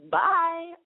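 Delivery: high, loud dismissive send-off. Retold or imitated. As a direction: toss it off as a dramatic brush-off. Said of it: "all dismissive, like".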